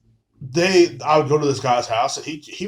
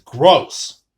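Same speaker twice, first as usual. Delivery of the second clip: disgusted; 7 dB louder than usual. Retold or imitated. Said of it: "He's looking at you guys in disgust".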